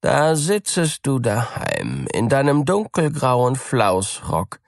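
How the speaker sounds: high, teasing cat voice; mocking cat. A narrator's reading. Cat teasing the mouse, high and mocking. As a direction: sneer at the stay-at-home mouse. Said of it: "sprach die Katze".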